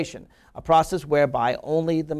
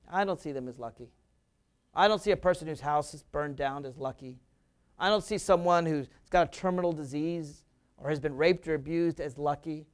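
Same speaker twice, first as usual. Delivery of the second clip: ordinary delivery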